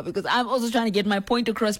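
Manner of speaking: ordinary delivery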